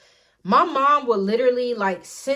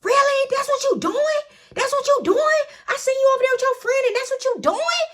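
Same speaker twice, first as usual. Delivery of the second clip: high, loud disbelieving yell; outraged mom; 3 dB louder than usual. Retold or imitated. Mom confronting daughter, high outraged yell. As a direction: hurl the questions as the betrayed mom. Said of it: "And she just like".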